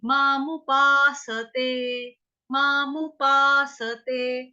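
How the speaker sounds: पासते with full स, three syllables; slow paasate. Shown as a demonstration. Teacher says पासते fully, not like pasta. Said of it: "स पूरा बोलेंगे".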